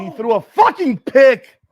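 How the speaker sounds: high, loud, slow cry of disbelief; outraged shout. Real, not acted. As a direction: yell it in anguish at the interception. Reacts to interception.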